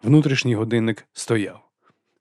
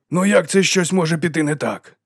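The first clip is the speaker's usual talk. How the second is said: high-pitched, exasperated protest; agitated ranting; 7 semitones higher than usual. A narrator's reading. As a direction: plead the case, worked up. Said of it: "розпинався Довбень, скажено махаючи руками".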